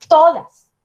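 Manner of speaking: "Todas" with the TO hit loud and falling; teacher demo, "TOdas" stressed; stress on first syllable. Shown as a demonstration. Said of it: "el golpe de voz cae en la primera".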